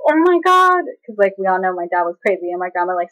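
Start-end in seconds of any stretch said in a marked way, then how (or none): 0.1-0.8 high, loud retold freak-out. Grandma hearing that dad is here, freaking out. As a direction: imitate grandma panicking. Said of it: "started freaking out".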